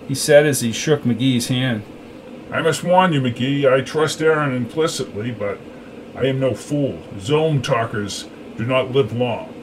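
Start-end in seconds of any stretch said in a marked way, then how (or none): 2.5-9.5 lightly voiced stern leader, slowing at the end; a hint of menace from a commander; warning first leader. A narrator's reading. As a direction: warn the newcomer with measured authority.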